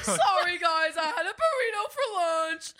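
high, loud, laughing through the words; giggling real embarrassment; laughing apology. Real, not acted. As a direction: crack up mid-apology. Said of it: "I know that one stung".

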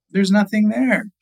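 high-pitched dismayed exclamation; upset discovery. Acted. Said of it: "he's upset".

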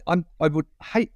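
normal talking voice

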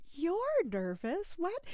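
high, drawn-out disbelieving question; incredulous. Retold or imitated. Mother-to-be reacting to her nervous partner, incredulous. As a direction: stretch it out in retold disbelief.

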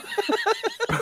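squealing laugh; shrieking with laughter; high burst of laughter. Real, not acted. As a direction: break into a high helpless laugh. Laughing at drunk mate story.

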